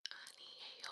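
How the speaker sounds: hurried whispered 아니에요; nervous, breathless 아니에요; rushed whisper. Real, not acted. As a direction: toss off 아니에요 under the breath. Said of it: "I talk fast".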